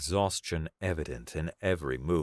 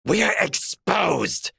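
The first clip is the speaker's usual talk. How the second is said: hissed shout; 7 dB louder than usual. A narrator's reading. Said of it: "Karath hissed".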